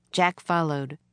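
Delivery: ordinary delivery, nothing marked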